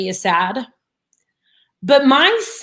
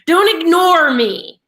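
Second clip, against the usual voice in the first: louder, slow stern demand; firm telling-off; 4 semitones higher, 5 dB louder, slower than usual. Retold or imitated. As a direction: put your foot down, slowly. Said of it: "But he's obviously emotional".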